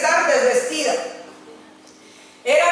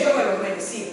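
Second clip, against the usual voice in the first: lower, hushed confession; 4 semitones lower, 7 dB quieter, faster than usual. Shown as a demonstration. Model confession of unworthiness.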